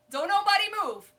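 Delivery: loud, hammed-up order to freeze; booming mock-serious command, the drama played for laughs; mock dramatic warning. To everyone in the room as the friend arrives, a loud mock-serious warning.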